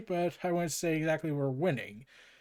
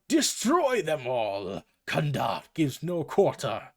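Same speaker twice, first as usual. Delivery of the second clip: slow, mock villainous yell; hammed-up, drawn-out battle command; 4 semitones lower, 4 dB louder, slower than usual.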